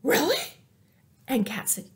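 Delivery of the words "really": rising much higher, amazed; surprised dog. Acted. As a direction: sound thrilled and amazed. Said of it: "And Dog said".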